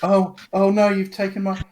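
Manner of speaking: mock dismay. Mock horror at rich loss.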